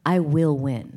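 low imitation of a determined father; mock-grave vow; solemn dad. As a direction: deliver it low, like a deadly serious gambler. Dad's solemn reply, retold.

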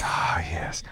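hushed, voiceless relish; retold whisper. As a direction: whisper it as if savouring a secret win. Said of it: "And he's like".

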